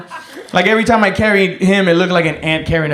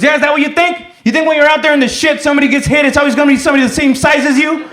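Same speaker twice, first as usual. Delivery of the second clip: high, raised-voice rant at a soldier; angry sergeant; 9 semitones higher, 4 dB louder, faster than usual. Retold or imitated. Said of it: "he got really angry".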